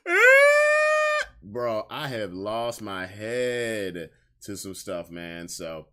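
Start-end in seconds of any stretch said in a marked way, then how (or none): 0.1-1.3 sudden high piercing cry; much higher, louder shriek of pure emotion; anguished yell. Retold or imitated. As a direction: let out a wild yell like the anime just broke you. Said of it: "made me just go".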